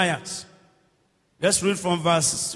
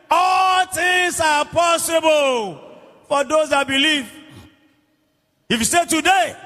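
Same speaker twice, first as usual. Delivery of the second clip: raised, emphatic preacher's challenge; forceful preaching; 5 semitones higher, slower than usual. Real, not acted. Preacher urging the congregation to believe for a miracle now, forceful preaching. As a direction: drive each phrase home to the crowd.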